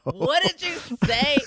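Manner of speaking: high, loud outraged shout; aunt yelling at her son after his insult; furious mom yell. Retold or imitated. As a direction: blast it at the kid in disbelief. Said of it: "yelling at her kid".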